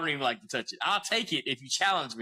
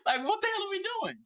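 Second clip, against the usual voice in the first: laughing disbelief; faster than usual. Real, not acted. Laughing at the absurd situation.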